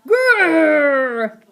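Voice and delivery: loud, long rolled growl; hammed-up attempt at a fierce dog. A narrator's reading. Slime as dog tries scaring cats, loud growl. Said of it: "Growl at them".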